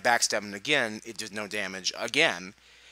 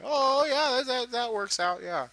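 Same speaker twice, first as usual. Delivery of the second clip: high, sarcastic mock agreement; sarcastic high-pitched "oh yeah, that works out"; sarcastic high falsetto; 5 dB louder than usual. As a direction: squeak it out with fake approval. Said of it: "I'm like".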